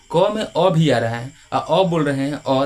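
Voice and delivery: slow, deliberate, each sound spelled out; slow teaching. Shown as a demonstration. Teacher to class, slowly showing अ inside क. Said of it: "देखिए ध्यान सुनिएगा".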